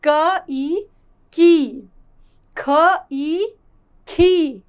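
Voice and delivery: letter, then ई, then the blend with a long drawn-out ee; long ee vowel drill. Shown as a demonstration. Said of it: "इसका उच्चारण लंबा होता है". (लंबी ई का उच्चारण)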